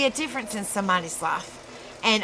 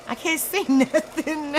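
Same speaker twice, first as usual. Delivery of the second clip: higher, breaking into laughter; laughing; 6 semitones higher than usual. Real, not acted. Streamer fumbling for a light in the dark, laughing. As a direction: crack up helplessly mid-sentence.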